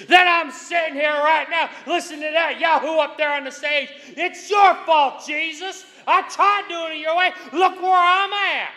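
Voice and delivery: high, indignant griping at Jesus; hammed-up complaining prayer; daring, accusing prayer. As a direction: nag and blame like a sulking churchgoer. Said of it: "daring him to respond".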